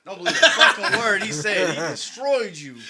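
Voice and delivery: telling him the truth through laughter; laughing aside. Retold or imitated. Narrator warning his brother, laughing loudly.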